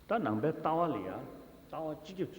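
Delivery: ordinary talk, nothing marked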